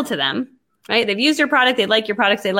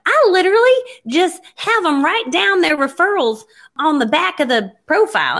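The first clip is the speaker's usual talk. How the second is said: put-on Tennessee accent; 7 semitones higher than usual. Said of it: "a girl from Tennessee with a real thick accent".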